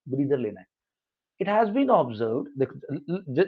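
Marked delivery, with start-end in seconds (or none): 1.4-2.5 slow, measured read with the pitch swinging on "observed"; model intonation. Shown as a demonstration. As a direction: read "It has been observed" slowly and expressively, as a model. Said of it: "देखो मैं कैसे पढ़ रहा हूँ".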